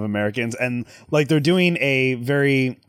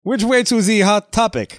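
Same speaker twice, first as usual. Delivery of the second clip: silly tourist voice; 7 semitones higher than usual. Pretend. Mock tourist riffing.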